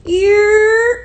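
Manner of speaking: loud, high, drawn-out holler; rising hometown call-out; New York "yerr" call. Shown as a demonstration. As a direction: holler a street call across the block. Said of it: "learn how to year too".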